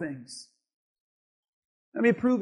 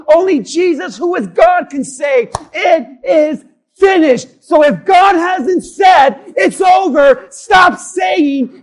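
shouted, raised sermon climax; full-force preaching; 5 semitones higher, 12 dB louder than usual. Acted. Preacher tells the church only Jesus can say it, full-force preaching. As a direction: hammer each phrase at the congregation.

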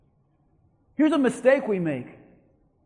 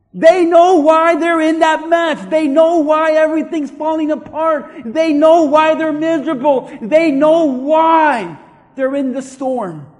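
loud, high, insistent repetition; forceful preaching; 4 semitones higher, 9 dB louder than usual. Real, not acted. Preacher insisting to the congregation they know why, forceful shout. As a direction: hammer each repeat at full volume.

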